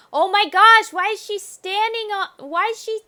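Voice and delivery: high, loud shocked gasp of disbelief; sudden alarm at the screen. Real, not acted. Player seeing her character step into fire, shocked.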